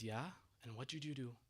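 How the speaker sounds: quietly threatening, as a tyrant who has just sat up. Retold or imitated. Yazid suddenly menacing, quiet.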